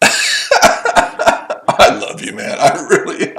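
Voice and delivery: long high laugh, then words squeezed out; laughing hard. Real, not acted. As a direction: barely get the words out for laughing. Cracking up at his opponent.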